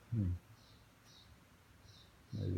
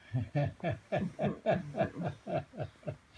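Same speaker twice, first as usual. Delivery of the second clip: high laugh; 10 semitones higher than usual. Real, not acted. Laughs at own remark.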